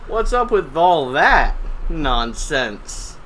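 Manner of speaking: slow loud mock stand-up voice; mock comedian bit. Teacher doing stand-up for class, hammed-up comic voice. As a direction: ham up a cheesy comic's opener. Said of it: "just, like".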